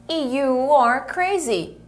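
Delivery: You are crazy said very slowly and clearly; slow, careful teacher demo. Shown as a demonstration. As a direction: spell it out slowly for the students. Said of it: "Eu falo".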